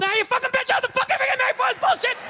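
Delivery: hammed-up furious dad; dad snapping. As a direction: explode at the dinner table.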